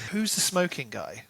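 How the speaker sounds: normal talking voice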